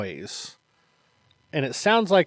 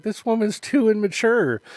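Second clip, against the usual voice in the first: higher-pitched imitated disapproval; mock judgement; 7 semitones higher than usual. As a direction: quote the judgement in a raised pitch. Retold imagined judgement.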